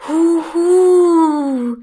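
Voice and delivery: loud, high, long-drawn ghost howl; spooky mock-ghost wail; ghostly hooting. A narrator's reading. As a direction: hoot like a spook, stretching the last vowel. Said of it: "macht es in dem Moment wieder".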